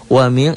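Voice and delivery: "Wa min" high, with the n hummed; ikhfa. Shown as a demonstration. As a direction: veil and hold the n of "Wa min". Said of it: "nun matinya ketemu shin". ("Wa min" dengan dengung ikhfa)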